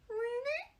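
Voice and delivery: very high, soft question; doubtful squeak. Acted. Mouse queen doubting the Scarecrow, soft squeak. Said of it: "asked the mouse".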